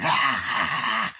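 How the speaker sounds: long stuttering demon cry; mock possessed creature sound; imitated demon noise. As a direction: cackle out a broken "aah".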